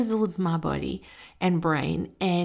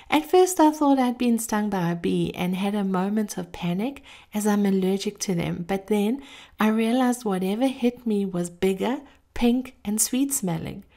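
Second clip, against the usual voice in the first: ordinary talk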